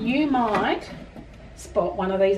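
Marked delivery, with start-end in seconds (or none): none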